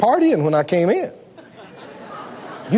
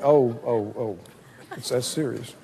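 much lower, hushed gasp of dread; hushed dismay; 11 semitones lower, 8 dB quieter than usual. Retold or imitated. People hearing grave news, low hushed dismay. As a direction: sink into worry. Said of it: "people think".

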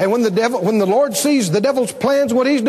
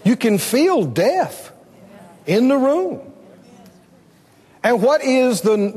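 usual speaking voice

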